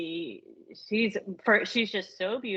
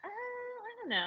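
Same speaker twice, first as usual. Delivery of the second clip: mock crying; 12 semitones higher, 10 dB quieter, slower than usual. Real, not acted. Overwhelmed by beauty.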